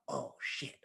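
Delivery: retold awestruck whisper; hushed shock. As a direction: let it out under the breath, stunned. Stunned by meeting time personified.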